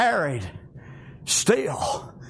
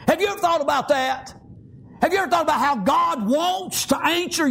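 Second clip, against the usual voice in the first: fervent preaching; 7 semitones higher than usual. Real, not acted. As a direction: preach it with conviction to the congregation. Preaching with force.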